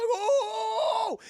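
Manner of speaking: long, high, loud "oh"; mock freak-out scream. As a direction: scream as killers close in. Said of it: "he fucking freaks out".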